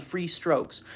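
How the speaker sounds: ordinary delivery, nothing marked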